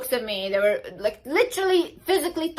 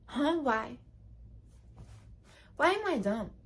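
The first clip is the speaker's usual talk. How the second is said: playing dumb question; 8 dB quieter than usual. Shown as a demonstration. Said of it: "Say like".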